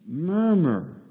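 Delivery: "Murmur" drawn out slowly like the sound itself; onomatopoeic murmur. Shown as a demonstration. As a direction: stretch "Murmur" into a long, rumbling mumble-word. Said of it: "it sounds like how it actually is".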